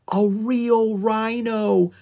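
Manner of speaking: very slow, high, loud wonder; amazed exclamation. A narrator's reading. Annie marvels as the rhino lumbers out, amazed exclamation. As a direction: stretch each word in amazement.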